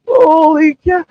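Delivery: loud, high burst of disbelief; amazed exclamation. Real, not acted. Streamer reacting to a clip of crying women, loud shocked outburst. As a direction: blurt it out, stunned by what he just heard.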